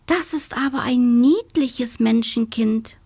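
lightly voiced little beetle girl; delighted child. A narrator's reading. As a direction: marvel at something adorable. Beetle child admiringly thinks about girl.

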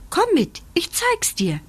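high-pitched little bunny; eager rabbit. A narrator's reading. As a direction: urge a friend to come along. Rabbit urges puppy along.

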